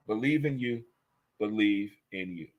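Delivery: Believe in you dito mais baixo e grave; soft encouragement. Real, not acted. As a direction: encorajamento suave, quase íntimo. Pep talk, softly repeated.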